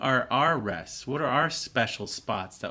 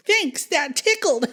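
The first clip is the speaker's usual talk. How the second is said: put-on high, giggly child voice; child tickled by the zap; laughing child; 5 dB louder than usual. A narrator's reading. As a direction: say it through a giggle. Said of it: "she laughed and said".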